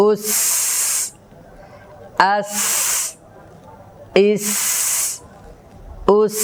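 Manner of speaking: short vowel, then long sharp hissed s in আস, ইস, উস; strong breath sibilant. Shown as a demonstration. Teacher drilling seen, long hiss ending আস, ইস, উস. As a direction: let the final s of আস, ইস, উস stream out forcefully. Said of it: "শ্বাসের আওয়াজটা খুব তেজ আওয়াজের সাথে দেব".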